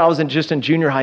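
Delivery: ordinary talk, nothing marked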